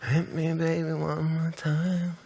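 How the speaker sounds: slow, drowsy and half-awake; sleepy voice. Retold or imitated. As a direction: murmur it like you're falling asleep. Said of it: "this very sleepy voice".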